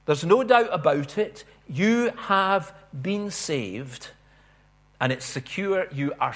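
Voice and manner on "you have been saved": "You" stressed, phrase drawn out very slowly; emphatic. Shown as a demonstration. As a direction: press on "You" and stretch the rest. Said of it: "that's very emphatic".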